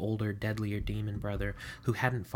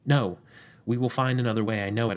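excited; 6 dB louder than usual. Acted. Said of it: "the excited demon says".

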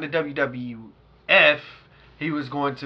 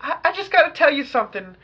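put-on girlfriend's voice; 13 semitones higher, 7 dB louder than usual. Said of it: "She's like".

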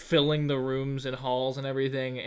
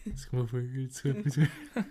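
ghostly men's voice; 4 semitones lower, 3 dB quieter, faster than usual. Pretend. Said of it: "supposed men voices".

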